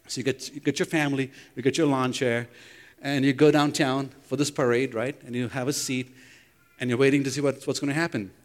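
ordinary talk, nothing marked

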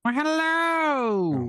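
high, very slow drawn-out hello; drawn-out put-on hello. Put-on greeting, host says Oh God.

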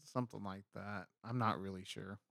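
quiet, talking while chewing gum. Real, not acted. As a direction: mumble around a wad of gum. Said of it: "Are you chewing gum?".

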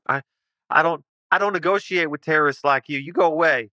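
high, fast, flustered refusal; defiant recluse. Acted. Homeowner refuses to negotiate with his attackers, defiant. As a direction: stammer a start, then rattle off defiance.

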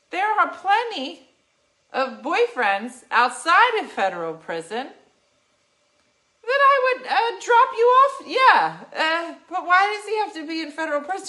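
high, loud incredulous protest; exasperated. Retold or imitated. Arguing with Jacqueline over paying her flight, high and loud.

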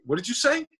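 challenging question pitched sharply up; acted challenge. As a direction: replay an affronted comeback, voice rising high. Confronting bouncer in retold quarrel.

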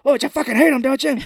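loud, high furious outburst; hammed-up angry shout; mock enraged goading. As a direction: explode while pushing him to agree.

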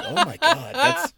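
high laughing oh my God; laughing disbelief. Retold or imitated. Grandson amazed as grandma wins argument, laughing high. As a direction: squeal it through a laugh. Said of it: "And I'm just like".

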